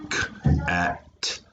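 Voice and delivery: "k-at" in separate, unblended sounds; "k-at" segmented, a gap after each sound; tapped out. Shown as a demonstration. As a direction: sound out "k-at" piece by piece. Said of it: "If you tap it out".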